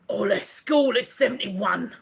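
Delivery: mock impression of a listener; put-on character voice, nothing like the real man; Jimmy. Host reading Jimmy's message, teasing put-on impression. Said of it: "I know you don't talk like that".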